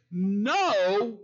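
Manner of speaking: a loud, hard "No."; flat refusal. Acted. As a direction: shut him down, a mild hard refusal. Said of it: "And this servant says".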